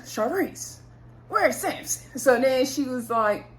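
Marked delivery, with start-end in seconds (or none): 0.1-2.2 high, put-on surprised greeting; imitating the host faking surprise; mock surprise. Host pretending to be surprised to see Sharice, mock surprise. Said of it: "he gonna act all surprised".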